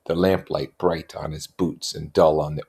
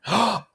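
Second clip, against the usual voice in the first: surprised gasp. A narrator's reading. Sudden recognition gasp.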